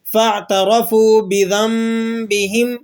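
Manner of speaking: measured Quran recitation with بِذَنبِهِمْ sounded "bizam-bihim"; tajweed iqlab recitation. Shown as a demonstration. Teacher reading the opened verse of Surah Mulk, tajweed iqlab recitation. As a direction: recite slowly, the noon of بِذَنبِهِمْ turned into a held humming m. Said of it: "ہم اسے کس طرح پڑھ رہے ہیں".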